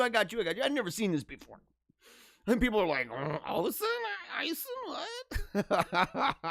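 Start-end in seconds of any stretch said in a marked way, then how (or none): 3.1-6.4 hammed-up confused sputtering; mock bafflement. Host imitating people baffled by a name, mock confusion. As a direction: stumble over it like someone lost, ending in a laugh.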